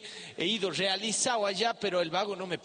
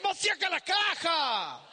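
mock yell; 10 semitones higher, slower than usual. Gearbox grinding exclamation.

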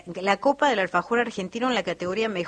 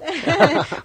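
laughing; 9 semitones higher, 7 dB louder than usual. Real, not acted. Presentadora ríe con el invitado.

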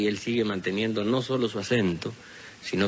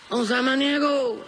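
calling out; slower than usual. Acted. Storyteller voices Florentín calling.

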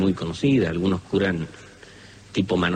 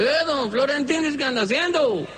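put-on old healer greeting. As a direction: greet him from the door, pitch up high. Healer greets visitor at gate.

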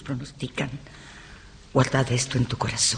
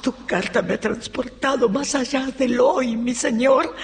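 high, elated welcome; rapturous greeting; 9 semitones higher than usual. Acted. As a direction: greet your husband high and swept away. Rapturous greeting of returning husband. (saludo extasiado, voz aguda)

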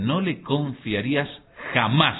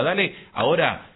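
ordinary talk, nothing marked

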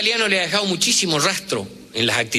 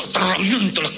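mock threat; 6 semitones higher than usual. Hot-headed threat quoted.

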